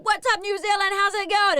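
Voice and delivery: high, loud mock rapper greeting; playful rapper hello; a hint of a hype rapper. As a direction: greet the crowd like a rapper, lightly voiced. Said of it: "And he's like".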